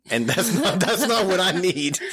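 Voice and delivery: high, slow words through laughter; laughing. Real, not acted. Storyteller cracking up over his feared noise at work, laughing. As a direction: laugh your way through the protest.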